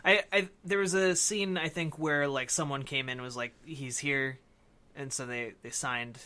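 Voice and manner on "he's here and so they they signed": usual speaking voice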